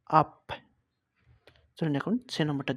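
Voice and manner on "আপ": "আপ" ending in a hard p; loud "আপ", the final b turned into p. Shown as a demonstration. Teacher showing learners b at word end, "আপ" closed with a p. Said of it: "উচ্চারণ হবে পর মতন".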